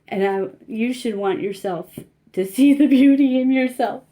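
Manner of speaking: real tears breaking through, half laughing; choked up. Real, not acted. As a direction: push through the lump in the throat. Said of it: "That's why I got a little choked up there".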